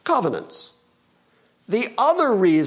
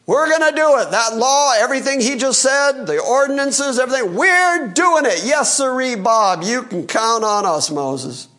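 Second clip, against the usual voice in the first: mock eager promise; 5 semitones higher than usual. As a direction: ham up a crowd swearing to obey. Mocking the eager liars.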